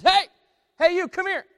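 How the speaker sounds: loud, high parrot squawk; parrot calling out. Acted. Parrot hailing the man who walked in, squawky shout. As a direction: call out sharply like a pet-store parrot.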